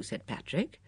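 usual speaking voice

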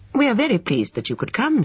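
polite hostess, pitched high. A narrator's reading. Hostess greets her tea guest, politely. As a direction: welcome a guest with courteous, lifted tones. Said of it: "said Emma politely".

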